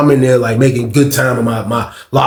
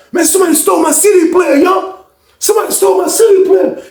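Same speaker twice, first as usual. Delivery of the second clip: very high, yelled complaint; outraged outcry; 3 dB louder than usual. Retold or imitated. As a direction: announce the theft to everyone. Acts out victim announcing theft.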